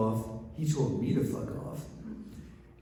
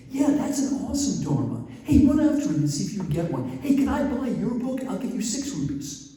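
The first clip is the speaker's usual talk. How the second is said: higher and louder, a quick excited plea; eager villager; 11 semitones higher, 5 dB louder than usual. Retold or imitated. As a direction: rush it like a man desperate to buy the book. Villager eager to buy the book.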